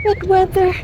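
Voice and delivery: very high put-on voice; joking squeak. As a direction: repeat it as a high-pitched joke. Joking repeat, followed by "Char. (pabirong matinis na boses)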